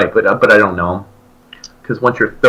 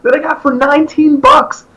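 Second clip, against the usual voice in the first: excited fan. Retold or imitated. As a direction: gush about the secret concert. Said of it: "I'm like".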